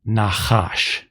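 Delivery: "Nachash" said slowly with a throaty ch in both syllables; Hebrew word demo. Shown as a demonstration. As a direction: model "Nachash" for the class. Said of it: "I'm gonna put a word up".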